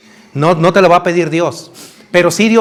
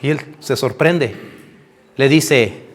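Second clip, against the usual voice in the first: ordinary talk, nothing marked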